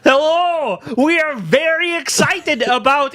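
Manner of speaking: high, fake-excited host voice; mock Norwegian presenter. Riffing on the Norwegian presenter, high fake-excited voice. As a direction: oversell the excitement. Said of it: "he was just like".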